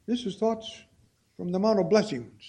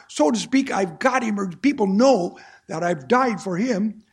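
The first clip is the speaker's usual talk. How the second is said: louder, rising preacher's fervour; fervent preaching; 3 semitones higher, 5 dB louder than usual. Acted. Preacher pressing the verse on his brothers and sisters, fervent. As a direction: preach it out to the pews.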